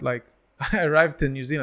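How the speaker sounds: ordinary talk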